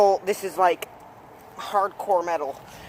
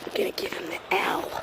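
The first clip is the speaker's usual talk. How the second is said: whispering; 5 dB quieter than usual. Pretend. Sneaking to neighbor's door.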